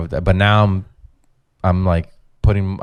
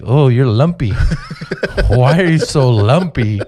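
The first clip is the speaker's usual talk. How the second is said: higher, louder mocking jeer; mocking relatives; 5 semitones higher, 4 dB louder than usual. Mocks relatives' remarks about his body.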